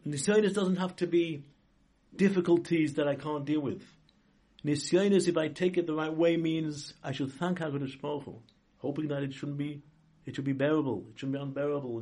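ordinary talk

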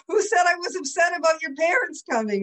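high, loud mock-indignant husband; indignant retort. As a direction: protest loudly as the wronged husband. Acted indignant husband in an example.

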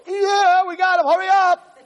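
high, loud, straining shout; panicked parent hollering under strain; frantic yelling. Acted. Parents holding boy down for doctor, frantic.

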